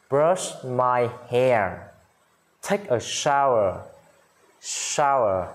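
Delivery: slow, drawn-out vocabulary drill; teacher's dictation pace, pauses between items; listen-and-repeat model reading. Shown as a demonstration. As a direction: say each phrase slowly and clearly for learners.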